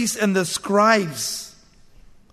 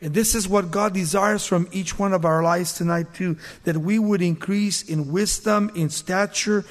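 normal talking voice

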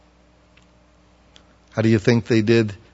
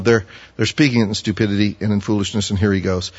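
ordinary talk

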